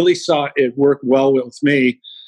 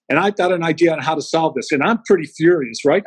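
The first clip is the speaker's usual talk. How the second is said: usual speaking voice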